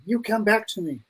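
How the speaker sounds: lightly voiced tearful movie heroine begging him home; Nicole Kidman in Cold Mountain. Host quoting a Cold Mountain line, lightly acted soft and high. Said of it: "I feel like Nicole Kidman in, in Cold Mountain".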